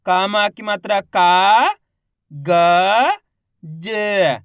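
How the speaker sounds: slow spelling, loud long का; isolated sounds with pauses between; letter by letter. Shown as a demonstration. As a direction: hold का, then ग and ज apart. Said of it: "किस तरीके से इनका उच्चारण करके".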